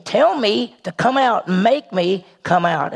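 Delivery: normal talking voice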